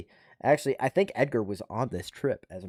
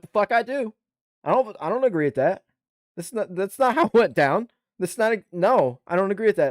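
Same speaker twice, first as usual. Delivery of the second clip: indignant refusal; 7 semitones higher than usual. Retold or imitated. Refusing to sign counseling statements.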